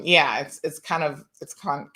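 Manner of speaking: ordinary talk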